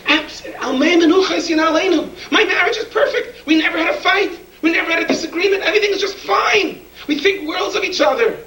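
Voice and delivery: hammed-up high-pitched denial; over-insistent young husband. Embarrassed young man assuring the Rebbe his marriage is perfect, high over-insistent voice.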